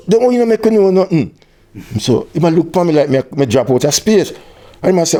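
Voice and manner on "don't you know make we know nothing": indignant question. Retold or imitated. Confronting Chilba about the secret version, indignant and high. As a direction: demand why nobody told them.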